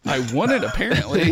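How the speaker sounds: high, loud, laughing; laughing admission. Real, not acted. Comics fan admitting accents defeat them, laughing. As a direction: admit it through a real laugh, pitch jumping up.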